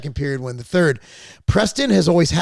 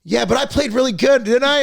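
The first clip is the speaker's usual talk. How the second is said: high, louder imitation of his proud son; proud kid voice; 9 semitones higher, 6 dB louder than usual. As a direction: sell it like a kid fishing for praise. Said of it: "He's like".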